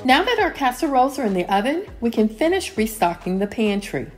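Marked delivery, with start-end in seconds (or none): none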